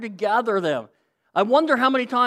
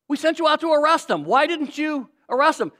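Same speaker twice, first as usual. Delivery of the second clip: higher, faster scolding question; indignant demand; 7 semitones higher, 3 dB louder than usual. Acted. Pharisees scold guards.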